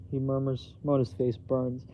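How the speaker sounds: usual speaking voice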